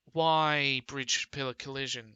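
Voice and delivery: loud exasperated why; real frustration at the error message. Real, not acted. Player reading the error aloud, loud and exasperated. Bridge fails again.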